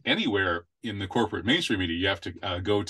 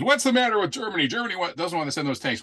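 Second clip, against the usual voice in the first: pitched way up and fast, mocking the headline; mock newspaper voice; 8 semitones higher, faster than usual. Host mocking the Washington Post pun, high and fast. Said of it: "their bad pun in the Washington Post".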